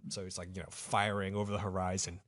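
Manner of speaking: ordinary talk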